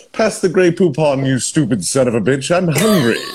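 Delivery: a snooty British gent demanding mustard; cultured British voice. Acted. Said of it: "a very high British cultured voice".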